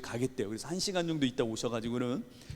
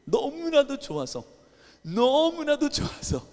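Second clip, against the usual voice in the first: overjoyed; 11 semitones higher, 4 dB louder, slower than usual. Retold or imitated. Pastor recalls joy at ordination.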